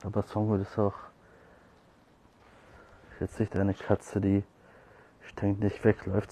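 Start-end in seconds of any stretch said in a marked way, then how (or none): none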